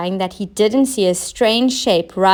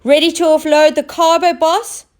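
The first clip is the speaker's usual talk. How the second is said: captain calling; 6 semitones higher, 4 dB louder than usual. Acted. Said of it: "called the captain".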